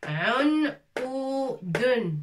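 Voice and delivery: "Un, ou, dun" split into separate beats; syllable by syllable. Shown as a demonstration. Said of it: "On n'a jamais vu ce mot".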